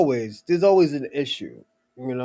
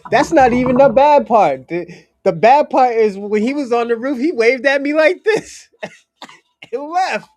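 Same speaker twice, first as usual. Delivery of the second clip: excited retelling; 9 semitones higher, 7 dB louder than usual. Retold or imitated. Retelling the cousin's shocked story.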